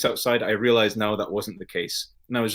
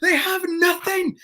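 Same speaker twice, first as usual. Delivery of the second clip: put-on drunken rant. At the festival, wailing drunkenly about wasted money. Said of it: "rampaging wanker".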